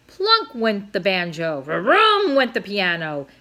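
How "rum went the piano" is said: plain reading voice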